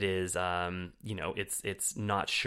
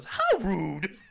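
high, loud sassy "How rude"; Michelle Tanner impression; 11 semitones higher, 7 dB louder than usual. Judi Dench mimicked doing a sitcom kid's catchphrase, high and sassy. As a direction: fling it out like the sitcom kid. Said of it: "does her best Michelle Tanner and goes".

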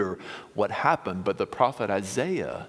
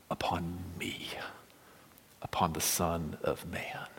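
softer, whispered reveal; reverent whisper; 4 semitones lower, 8 dB quieter than usual. Acted. Jesus revealing himself to Nathanael, whispered. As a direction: let the words fall to a hush.

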